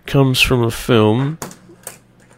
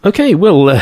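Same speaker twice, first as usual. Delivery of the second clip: ordinary talk, nothing marked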